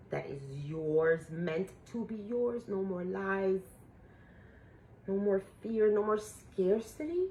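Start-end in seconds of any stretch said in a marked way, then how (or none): none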